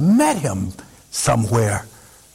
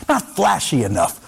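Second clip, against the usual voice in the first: ordinary delivery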